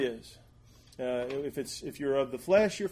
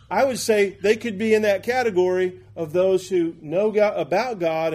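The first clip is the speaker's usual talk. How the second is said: raised, louder preacher's voice; emphatic preaching; 5 semitones higher, 7 dB louder than usual. Acted. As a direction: preach it with force and conviction. Preaches with rising force.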